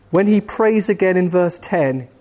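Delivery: normal talking voice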